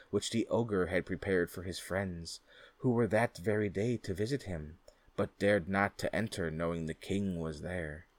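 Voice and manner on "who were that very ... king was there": ordinary delivery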